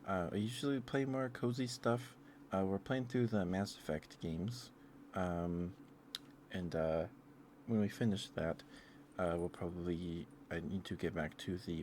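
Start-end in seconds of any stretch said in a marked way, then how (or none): none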